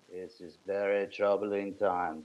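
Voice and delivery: deadpan mock-grave Russian accent. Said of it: "I don't have a Russian accent".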